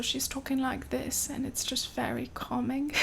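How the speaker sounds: hushed, soothing British accent; a lab technician talking gently in a British accent; soft British accent. Shown as a demonstration. A calming British accent, hushed and soothing. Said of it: "has a British accent".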